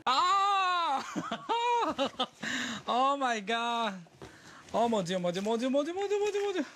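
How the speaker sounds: shrieking laugh rising into a high chant; ecstatic shriek. Real, not acted. Unboxing a Barbie, shrieks.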